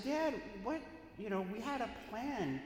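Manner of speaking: high, incredulous protest; high-pitched exasperated plea; exasperated squeal. Retold or imitated. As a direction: voice jumps up in disbelief.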